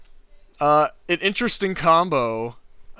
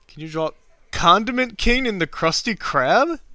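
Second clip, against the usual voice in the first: goofy voice; 7 semitones higher, 6 dB louder than usual. Pretend. Reading request in funny voice.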